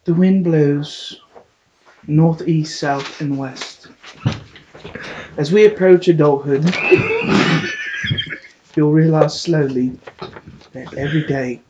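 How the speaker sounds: slow, hushed, solemn reading; mock solemn narration. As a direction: intone it like a wise elder.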